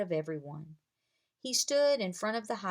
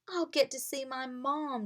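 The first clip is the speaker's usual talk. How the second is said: higher, happy outburst; excited cry; 6 semitones higher than usual. A narrator's reading. Boy excited to see his mom, crying out. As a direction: blurt it out with joy. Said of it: "Drake cried".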